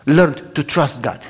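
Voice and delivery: ordinary delivery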